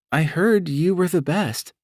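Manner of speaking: a nervous client hoping for reassurance; Brandy voice. Acted. Brandy's voice in audiobook.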